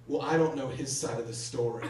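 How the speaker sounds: put-on ex-girlfriend quote. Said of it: "She goes".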